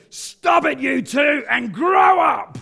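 high, loud booming command to two grown men; booming shout. Retold or imitated. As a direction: boom it out like a furious wife stopping a fight. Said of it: "this voice boomed".

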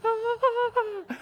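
high-pitched comic wail; mock scream. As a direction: pretend to flee screaming. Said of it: "just run away screaming".

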